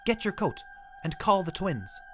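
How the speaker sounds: Ed warns his wife they are coming; urgent husband. Acted. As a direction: urge her, hunted and hurried.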